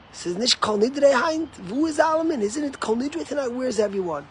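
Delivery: high-pitched incredulous questions in a retold voice; mock-alarmed questioning; bewildered stranger. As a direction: imitate a baffled latecomer, pitch jumping up. Stranger bewildered at missing Kol Nidre.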